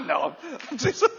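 high, loud words through laughter; laughing. Real, not acted. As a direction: take back the joke while laughing.

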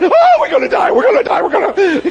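high, loud mock panic; panicked servant. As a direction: squeal it out like a terrified servant. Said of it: "servant is going crazy".

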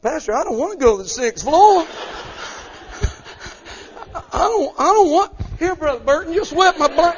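put-on high, loud childish plea with laughs; begging like a little boy. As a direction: beg the pastor like a scared kid. Grown man begging like boy.

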